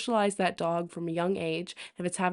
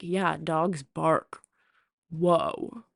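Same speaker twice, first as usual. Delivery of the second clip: slow sarcastic drawl; much slower than usual. Retold or imitated. Sarcastic reply.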